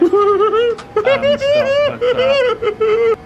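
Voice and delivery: high and loud; loud filler. Real, not acted. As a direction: burst out the filler. Loud filler between quiet lines.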